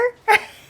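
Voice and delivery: high, loud burst of laughter; real laugh at the memory. Real, not acted. Speaker laughing loudly while telling the childhood story.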